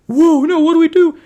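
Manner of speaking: very high, loud panicked cry; loud high-pitched alarm; panicked squeal. Retold or imitated. Panicked elves voiced.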